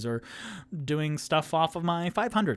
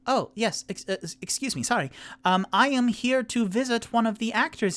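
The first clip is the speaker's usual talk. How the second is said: raised, higher polite repeat; louder for deaf doorwoman; 9 semitones higher, faster than usual. Acted. Rock repeating himself louder to the woman. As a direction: speak up so she can hear. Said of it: "the woman holds hand to ear".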